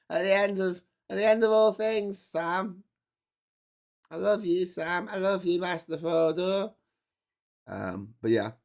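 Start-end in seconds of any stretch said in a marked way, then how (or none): 0.1-6.7 helium hobbit voice. Pretend. Vlogger acting a hobbit scene, high squeaky voice. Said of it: "No one thought of that".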